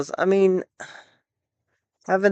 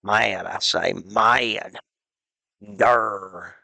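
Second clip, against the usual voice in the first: goofy low voice; 9 semitones lower, 5 dB quieter, slower than usual. Pretend. Gamer switching to a silly dopey character voice. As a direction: lower and softer, daft cartoon voice.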